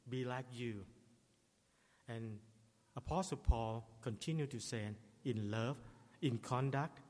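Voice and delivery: usual speaking voice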